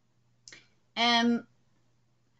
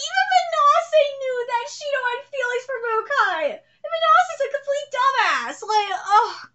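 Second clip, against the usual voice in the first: exasperated rant; 13 semitones higher, 8 dB louder than usual. Real, not acted. Ranting at character choice.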